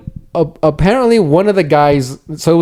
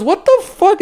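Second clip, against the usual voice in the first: much higher startled exclamation; mock disbelief. Stranger reacting to the mechanic's outburst, high mock shock.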